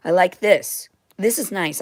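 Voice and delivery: ordinary talk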